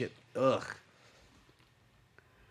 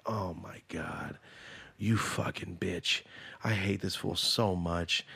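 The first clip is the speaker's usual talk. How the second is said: slow disgust; 3 semitones lower, slower than usual. Real, not acted. Recognizing a hated voice.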